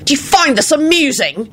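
high, indignant demand; screamed in rage. A narrator's reading. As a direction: demand it furiously. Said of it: "he screamed".

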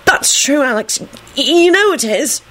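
put-on high, hesitant boy voice; chiming in. A narrator's reading. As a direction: back up a friend timidly. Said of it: "Melvin chimed in".

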